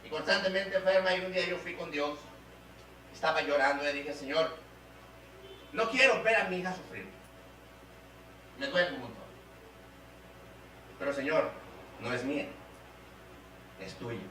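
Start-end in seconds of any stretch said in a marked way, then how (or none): 4.1-14.2 hushed, lowered pleading with long pauses; retold prayer, sinking softer into surrender; sorrowful prayer. Father praying to God over his sick daughter, sorrowful.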